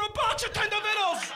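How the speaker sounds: high, loud outburst on the punchline; incredulous punchline. Acted. Comedian ending the cat story for the crowd, incredulous outburst. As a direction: punch out "box of Tender Vittles" high and loud. Exasperated punchline, first take.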